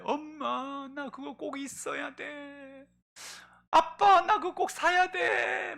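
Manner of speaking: put-on high, slow whining child's wail; crying child. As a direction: beg like a child crying for a toy. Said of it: "막 이렇게 울어". (높고 느리게 떼쓰며 우는 아이)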